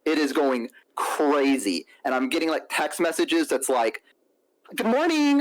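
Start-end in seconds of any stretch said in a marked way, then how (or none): 4.8-5.4 high-pitched mock morning greeting; imitated chirpy texter. Date texting him after the chase, high mock-cheery voice. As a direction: put on her high, sing-out greeting. Mimicking the woman's texts.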